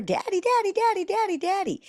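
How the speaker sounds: put-on very high, insistent child calling daddy; toddler calling daddy. Mother imitating a toddler calling for daddy. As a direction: pester dad like a small child. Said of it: "your toddler is screaming your name".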